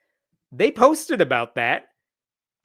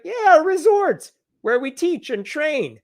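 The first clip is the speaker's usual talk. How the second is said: mock breezy pastor; 11 semitones higher, 4 dB louder than usual. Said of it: "And he's like".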